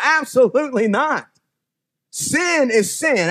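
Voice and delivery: slow preacher emphasis; emphatic slow. Real, not acted. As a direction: hammer it down slowly.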